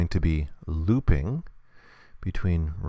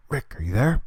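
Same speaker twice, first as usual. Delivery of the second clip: higher, mild pretend call; 5 semitones higher than usual. Michonne searching for Rick, acted calling out. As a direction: call out questioningly, without really shouting. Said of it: "she's gonna call out".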